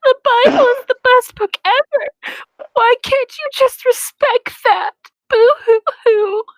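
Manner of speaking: high girlish voice, slowing into a fake boo-hoo sob; mock crying girl, drawn out; hammed-up sobbing. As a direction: overact a weepy girl crying. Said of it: "Hermione sobbed femininely".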